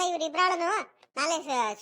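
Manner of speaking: normal talking voice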